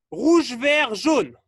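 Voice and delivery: loud colour calls; called-out command. Real, not acted. Coach sends players to coloured cones, loud call. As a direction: call each colour out firmly.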